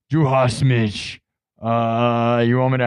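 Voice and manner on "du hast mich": "du hast mich" said slowly and carefully in German. Shown as a demonstration. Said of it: "correcting my German accent".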